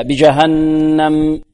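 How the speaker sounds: "بجهنم" very slow, humming long on the doubled n; nasalised doubled nun. Shown as a demonstration. Teacher applying the rule for doubled nun, long ghunna. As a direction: hold the n of "بجهنم" in a steady nasal hum. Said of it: "وغن ميما ثم نونا شددا".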